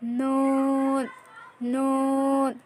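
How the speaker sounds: নুন drawn very slowly, tongue tip on the palate, lips rounded; Arabic letter demo. Shown as a demonstration. Teacher showing students how to say নুন. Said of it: "জিহ্বার আগা উপরের তালুর সাথে লাগিয়ে ঠোঁট অবশ্যই গোল করতে হবে".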